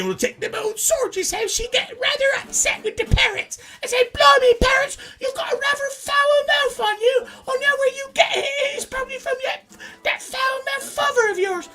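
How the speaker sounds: very high falsetto mock-English mum voice; scolding English mother. Upset mother scolding her son over foul-mouthed parrots, high falsetto. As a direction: scold in a piercing, hammed-up old mum falsetto. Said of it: "Well, Mama, I'm not really their father".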